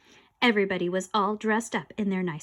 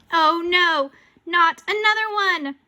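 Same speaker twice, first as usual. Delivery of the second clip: higher, louder cry of dismay; dismayed dread; 8 semitones higher, 8 dB louder, slower than usual. Acted. Child hears another ghost coming, acted loud dismay. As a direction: groan it out high and loud.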